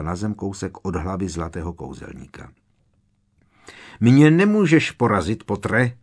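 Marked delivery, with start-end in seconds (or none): 4.0-6.0 loud, higher taunting shout. A narrator's reading. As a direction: yell it at Potter in triumph. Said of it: "křičela Bellatrix". (hlasitý výkřik)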